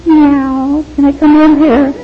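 high, small, timid voice asking permission; a lion squeaking politely like a scared kitten; timid meek lion. Pretend. Preacher mocking a timid lion, high and meek. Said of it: "You don't have a lion going".

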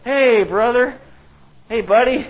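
sly Cain. Retold or imitated. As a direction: lure your brother out sweetly. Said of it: "Cain said to his brother Abel".

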